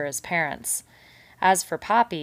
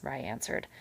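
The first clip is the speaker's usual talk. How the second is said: usual speaking voice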